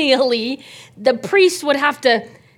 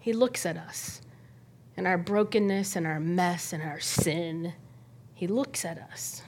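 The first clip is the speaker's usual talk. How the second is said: tender awe; 6 semitones lower, 11 dB quieter than usual. Real, not acted. Pastor marveling at Jesus' gaze, soft and tender. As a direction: speak it with quiet wonder. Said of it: "That's what I love about Jesus".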